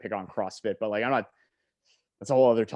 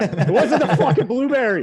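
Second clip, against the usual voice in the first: loud, higher hammed-up Boston accent; 10 semitones higher, 10 dB louder than usual. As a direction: shout it like a laughing Boston guy. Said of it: "every time I do a Boston accent".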